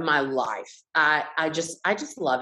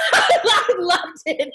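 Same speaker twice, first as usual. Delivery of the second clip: laughing; 13 semitones higher, 4 dB louder, much slower than usual. Real, not acted. Gleeful retelling.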